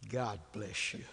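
soft, low, calm blessing; calm wife. Retold or imitated. Calm wife answering his complaints, soft and low. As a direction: say it gently, like nothing bothers you. Wife's calm reply again.

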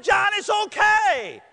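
high, loud, stretched-out reassurance; exaggerated consoling shout. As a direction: cry it out high and drawn-out. Coach being crushed in tearful hug.

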